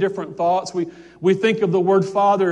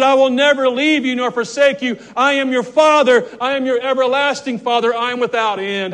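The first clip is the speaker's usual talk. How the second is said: high, loud, ringing declaration; preaching the voice of Jesus; 5 semitones higher, 5 dB louder than usual. Acted. Preacher voicing Jesus's promise to the church, loud proclamation. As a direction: proclaim it as the Lord's own promise. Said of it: "He said".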